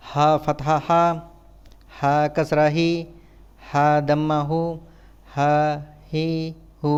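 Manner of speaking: even, monotone syllable-by-syllable drill; measured classroom letter drill; spelling drill recitation. Shown as a demonstration. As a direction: recite it like a spelling chant, pausing between items.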